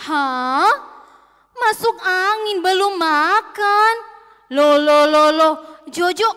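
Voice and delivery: loud, drawn-out disbelief; acted mother's outburst of astonishment; shocked. A narrator's reading. Mother hears the doctor say Jojo hasn't eaten, shocked. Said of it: "Mamanya langsung kaget".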